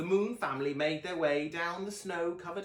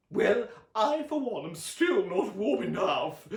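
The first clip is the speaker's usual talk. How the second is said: high, loud, slow playful aunt; Aunt Summer hints to Sonny, loud playful declaration; Aunt Summer declaring; 8 semitones higher, 7 dB louder, slower than usual. A narrator's reading. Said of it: "Aunt Summer declared".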